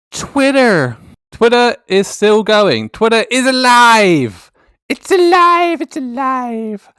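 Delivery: high, loud, drawn-out monster-movie cry; slow, exultant mock-horror proclamation; mad scientist cry. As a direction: ham it up like Frankenstein reviving his creation. Hammed-up Frankenstein movie cry.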